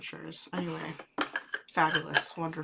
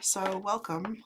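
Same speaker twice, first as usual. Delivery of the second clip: ordinary talk, nothing marked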